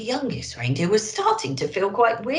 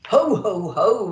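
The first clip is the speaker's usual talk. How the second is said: louder Santa laugh; jolly ho ho ho; 3 semitones higher, 6 dB louder than usual. A narrator's reading. Santa laughing as they speed home, hearty. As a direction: give a big jolly belly laugh. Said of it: "laughed Santa".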